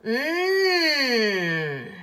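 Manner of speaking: long nasal N hum, tongue up; the N sound. Shown as a demonstration. Teacher humming the N sound for pupils. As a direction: hum the N steadily. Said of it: "lowercase n".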